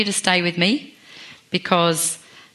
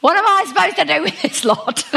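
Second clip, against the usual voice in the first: much higher, laughing, exasperated imitation; exasperated Moses; 11 semitones higher, 4 dB louder than usual. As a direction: throw up your hands in comic frustration, laughing. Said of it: "I reckon he was like".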